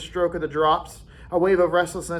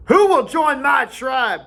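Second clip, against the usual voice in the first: slower, higher, louder rallying; slow loud call; 8 semitones higher, 6 dB louder, slower than usual. A narrator's reading. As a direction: call the tribe again. Jack repeats recruiting call.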